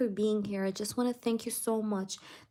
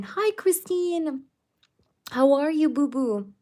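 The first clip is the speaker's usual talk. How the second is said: sweet nothings; 6 semitones higher, 8 dB louder than usual. Real, not acted. Greeting a beloved viewer affectionately.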